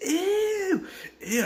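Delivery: high, laughing squeal of disgust; grossed-out ew. Retold or imitated. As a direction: squeal like a teen dodging grandma's hands. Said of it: "I'm just like".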